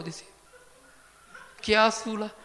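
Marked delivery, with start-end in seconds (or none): 1.6-2.3 loud, higher, suspicious mock challenge; startled retort. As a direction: bark it out like a wary stranger. People reacting to a greeting.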